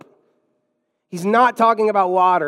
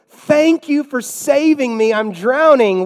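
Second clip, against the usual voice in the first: high, loud and drawn-out gratitude; acted, over-the-top thanks; mock grateful cry; 7 semitones higher, 6 dB louder than usual. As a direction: call out slowly like a rescued swimmer. Grateful drowning person voiced in example.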